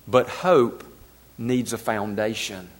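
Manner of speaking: low, soft, grieving quote; hushed and heavy with sorrow; profound sadness. Retold or imitated. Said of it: "in profound sadness".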